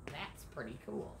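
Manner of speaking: soft unvoiced aside; whisper. Shown as a demonstration. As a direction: whisper it to the children. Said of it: "aside whispered to listeners".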